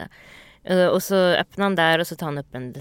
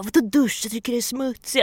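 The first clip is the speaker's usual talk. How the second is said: grumpy; 6 semitones higher than usual. Retold or imitated. Girlfriend woken too early by her boyfriend, mock grumpy. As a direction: play the cranky just-woken girlfriend.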